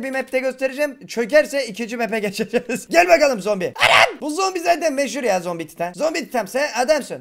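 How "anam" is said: low loud startled yelp; real startled cry. Real, not acted. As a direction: blurt it out in shock. Sudden scare from zombie.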